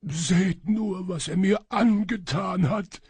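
high, loud wailing voice; plaintive lament. A narrator's reading. Shadow servant shows master his wounds, lamenting. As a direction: wail it to gain the master's pity. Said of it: "klagte der Schatten".

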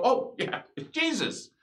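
high, amazed voice of the waking rocks. Pretend. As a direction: play the stones suddenly recognizing Jesus. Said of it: "they'd wake up and go".

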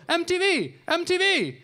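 a starstruck fan pointing at him; excited fans calling out. Retold or imitated. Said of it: "and they go".

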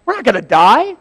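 acted angry outburst. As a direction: berate foolish followers. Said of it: "very angry. He's like".

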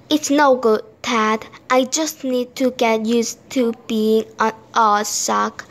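ordinary delivery, nothing marked

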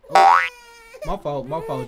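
loud sudden oh then quick sorry; caught-off-guard yelp and sorry; startled apology. Real, not acted. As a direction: blurt out the apology in alarm. Accidentally hurt baby.

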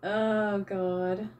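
ordinary talk

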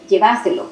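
"Lleváselo" stressed on VA; South American stress, lleVÁselo. Shown as a demonstration. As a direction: say "Lleváselo" as lle-VA-se-lo. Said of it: "podrían decir".

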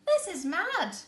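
loud, high disbelief; child exclaiming at mum's odd list. Acted. Child shocked at mad list.

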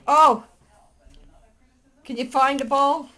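ordinary delivery

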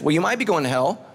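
ordinary talk, nothing marked

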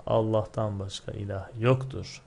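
ordinary delivery

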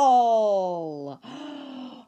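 loud dramatic gasp at the blank wall; sharp gasp. Acted. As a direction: gasp as if you just saw trouble. Liza finds the blank wall.

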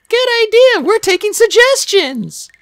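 high, loud, playful character voice; jokey, drawn-out high voice; joking. A narrator's reading. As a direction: call it out high as a light joke. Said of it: "she joked".

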